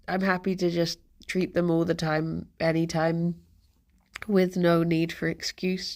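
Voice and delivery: usual speaking voice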